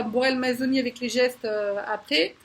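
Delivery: ordinary delivery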